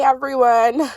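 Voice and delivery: ordinary delivery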